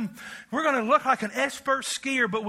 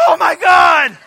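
loud panicked shout, much higher; terrified cry; 8 semitones higher, 16 dB louder, slower than usual. Retold or imitated. Preacher imitating a skier screaming before the fall. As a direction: scream as the ground rushes up. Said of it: "and they're going".

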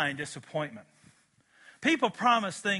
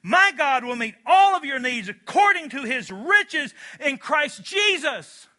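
loud, higher, ringing delivery; joyful proclaiming; 9 semitones higher, 10 dB louder than usual. Real, not acted. Preacher exulting over God's promises to the church. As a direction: lift the whole room with it.

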